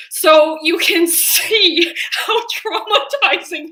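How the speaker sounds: high, loud, drawn out through laughter; laughing. Real, not acted. Telling listeners about being locked in, laughing. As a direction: break up laughing mid-sentence. Laughs at own trauma story.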